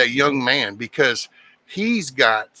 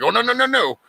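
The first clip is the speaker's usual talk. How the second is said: high-pitched string of no's; mock alarmed objection; 11 semitones higher, faster than usual. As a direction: jump up high to stop them right there. Acted objection to complacent people.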